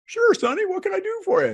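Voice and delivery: hammed-up old gentleman, high; old man voice. Old man answers young man.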